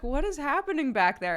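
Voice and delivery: mock-exasperated driver mom; exasperated parent. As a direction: call to the back seat, fed up. Retells reacting to mean child.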